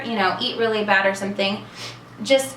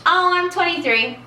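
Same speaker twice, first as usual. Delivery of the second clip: pitched way up, playfully fibbing about her age; high, coy, pretend twenty-two; mock youthful answer; 9 semitones higher than usual. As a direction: chirp it like a young girl pretending. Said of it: "I feel like saying".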